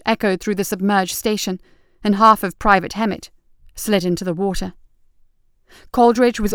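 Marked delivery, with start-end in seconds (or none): none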